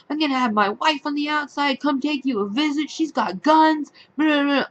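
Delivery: high-pitched imitation of angry threats; mock his ranting threats, voice pushed up; mocked threatening rant. Patient threatening her with his wife, mock ranting.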